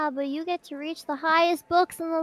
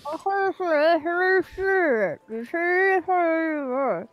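talking through a mouthful of sweet roll; words muffled by a big bite of food; mouth full; 4 dB louder, much slower than usual. Real, not acted. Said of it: "don't talk with your mouth full".

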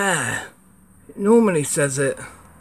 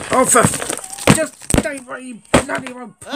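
high, exasperated cursing at the camera; frustrated outburst; 9 semitones higher than usual. Real, not acted. Reviewer fighting his camera setup, a frustrated outburst. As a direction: snap at the gear that won't cooperate. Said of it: "Getting very angry with it".

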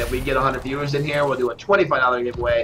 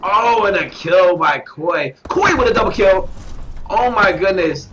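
a burst of higher, louder calling as the double kill lands; excited play-by-play; 5 semitones higher, 4 dB louder than usual. Real, not acted. Caster hyping a double kill to stream viewers, excited and loud.

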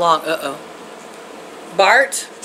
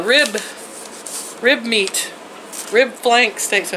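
usual speaking voice

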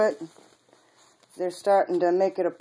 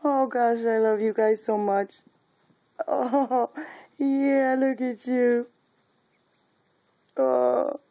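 much higher, gushing puppy talk; 7 semitones higher than usual. Real, not acted. Telling the puppies she loves them, in high baby talk. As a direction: melt over the puppies up high.

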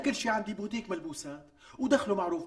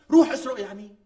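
sarcastic outburst; 2 semitones higher, 10 dB louder than usual. Acted. Husband's sarcastic loud retort.

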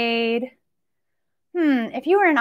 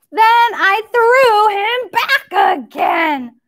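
loud, higher and slow; big rhyme line; 7 semitones higher, 3 dB louder, slower than usual. Acted. Teacher calling out the counting rhyme to kids, loud and slow. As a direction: call out the line with gusto.

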